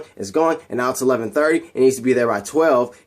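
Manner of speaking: ordinary talk, nothing marked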